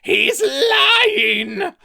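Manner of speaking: high, loud screaming denial; cornered traitor screaming at the captain. A narrator's reading. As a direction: shriek it in panic. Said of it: "Spleeth screamed".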